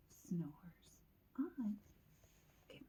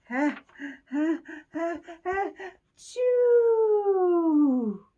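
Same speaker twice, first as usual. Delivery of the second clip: high, loud drawn-out "ah-choo"; huge pretend sneeze; 9 semitones higher, 11 dB louder, much slower than usual. Pepper flake makes Bear sneeze, huge pretend sneeze. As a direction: build gasps into an explosive fake sneeze. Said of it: "pepper makes you sneeze".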